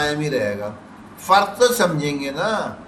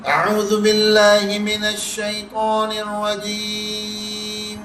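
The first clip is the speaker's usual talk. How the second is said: slow, drawn-out melodic tilawat, higher pitch; Quranic recitation; 5 semitones higher, much slower than usual. Shown as a demonstration. Teacher opening the surah with slow chanted recitation. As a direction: chant it slowly like a qari.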